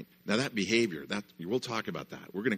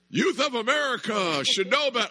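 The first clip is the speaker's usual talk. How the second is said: raised-pitch, loud mock lecture; pompous rant to buy time; stalling neighbor speech; 9 dB louder than usual. Angry neighbor stalling the kids, mock-pompous and loud.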